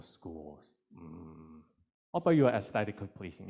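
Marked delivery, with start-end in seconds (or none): 2.1-3.5 excited quick save. Acted. Voicing someone's quick correction.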